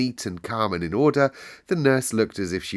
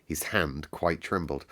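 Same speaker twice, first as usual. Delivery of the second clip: ordinary delivery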